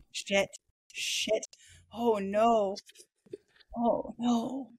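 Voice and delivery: usual speaking voice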